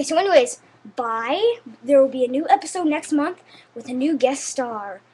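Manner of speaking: ordinary delivery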